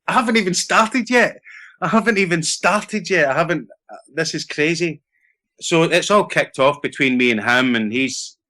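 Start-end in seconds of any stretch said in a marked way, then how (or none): 0.1-5.0 high-pitched, disbelieving protest, retold; incredulous protest. New actor retelling his protest at being thrown off the job, incredulous. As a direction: plead your case in a higher, bewildered voice.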